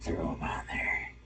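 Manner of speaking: creepy whispered voice; breathy whisper, trying to be creepy. Acted. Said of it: "That's my creepy voice".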